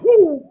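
high mock siren wail woo; siren noise. Siren imitation in retold arrest.

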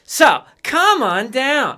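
slow, drawn-out pitchman call; hamming up a local car dealer ad; mock car ad pitch.

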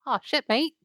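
playful Australian caricature; mock Australian accent. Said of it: "with an Australian accent".

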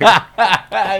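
high burst of real laughter. Real, not acted. Friend cracks up at the sleep paralysis joke, laughing out loud.

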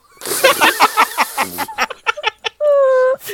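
long high laughing fit; hysterical laughter. Real, not acted. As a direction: burst out laughing. Laughing hard.

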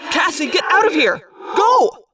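high, loud shouted order; forceful shout, rising in urgency; urgent yell. Acted. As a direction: yell to send a friend away from danger. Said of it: "I yelled".

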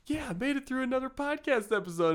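a lightly voiced Howard sighing in relief; relieved Dwight Howard. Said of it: "Dwight Howard's like".

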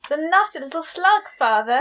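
Draco Malfoy. Acted. Co-host recalling the slug joke, lightly imitated Draco Malfoy. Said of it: "a voice that reminded me of Draco Malfoy".

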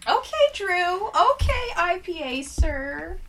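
high, loud excited squeal. Real, not acted. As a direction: squeal it with delight. Excited squeal at IPA guess.

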